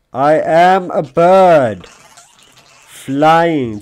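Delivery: hammed-up soaring bird; soaring proclamation. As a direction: stretch it grandly. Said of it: "grand drawn claim".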